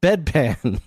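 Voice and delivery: ordinary delivery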